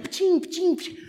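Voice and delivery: high loud pulsing noise; imitated racing heartbeat. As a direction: mimic feelings pounding away.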